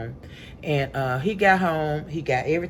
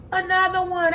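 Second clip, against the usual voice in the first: high, sustained mock cry of alarm; acted alarm; 3 dB louder than usual. Woman alerting hubby mid-call to another bug, alarmed. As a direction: call it out on one high held note.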